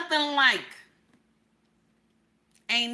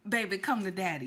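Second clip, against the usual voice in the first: seductive whisper; 7 semitones lower, 8 dB quieter, faster than usual. Retold or imitated. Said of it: "a still small voice whisper".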